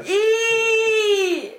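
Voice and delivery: a loud high cry of disgust "Ih!"; disgusted squeal. Real, not acted. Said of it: "ich kann mich echt total selber verekeln".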